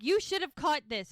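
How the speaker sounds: high, loud mock scolding; imitated yell. Hope yelling at Lizzie over the misprint, mock angry. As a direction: bark the blame like Hope. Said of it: "yells at Lizzie".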